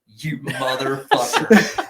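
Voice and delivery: slow, loud, cursing through laughter; laughing slow mock insult; laughing curse. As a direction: curse him out while cracking up. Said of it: "I'm like".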